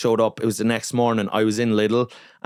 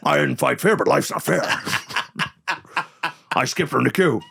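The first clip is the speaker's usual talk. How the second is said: gloating villain; 3 semitones higher than usual. Pretend. Gloating imagined retort.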